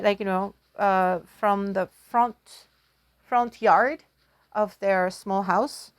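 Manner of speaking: plain reading voice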